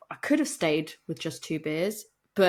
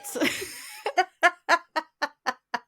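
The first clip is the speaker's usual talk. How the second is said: high laugh; 9 semitones higher than usual. Real, not acted. Laughing at own joke.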